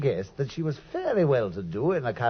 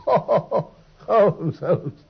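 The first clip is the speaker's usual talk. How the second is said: laughing; 3 semitones higher, 5 dB louder than usual. Acted. Watson laughs at Holmes.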